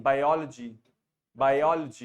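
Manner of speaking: "biology" as bai-OL-uh-jee, not bio-lo-jee; correct pronunciation. Shown as a demonstration. Teacher corrects a student's ending, modelled pronunciation. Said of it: "हाँ, लॉजी नहीं".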